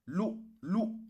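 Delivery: "Lou, lou", each swept up high; high, rising "Lou, lou". Shown as a demonstration. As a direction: let the voice climb on each "lou". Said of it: "la voix monte".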